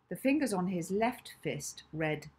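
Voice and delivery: usual speaking voice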